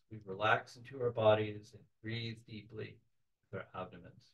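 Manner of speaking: soft, unhurried meditation voice with long pauses; softly led visualization, phrase by phrase; guided meditation. Real, not acted. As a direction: gently guide the group into a relaxed trance.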